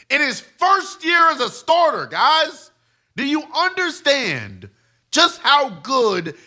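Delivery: high-pitched, emphatic disbelief; impassioned rant. Real, not acted. Impassioned rant defending Mahomes' greatness.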